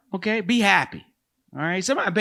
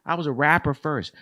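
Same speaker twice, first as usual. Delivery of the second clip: ordinary talk, nothing marked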